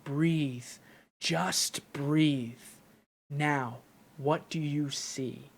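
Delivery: soft, slow quote with pauses; Luke. Retold or imitated. Fan quoting the mentor's voice from the trailer, softly imitated. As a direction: lightly imitate a calm trailer voice-over, hushed and measured. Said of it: "you hear Luke's voice saying".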